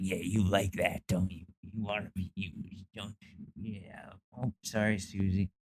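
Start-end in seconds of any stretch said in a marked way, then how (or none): 0.0-1.4 creepy predator voice. Retold or imitated. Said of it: "he's like". 1.6-3.5 mock sleazy whisper; creepy hushed predator. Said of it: "he's like". 3.5-4.1 creepy predator voice. Retold or imitated. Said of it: "he's like".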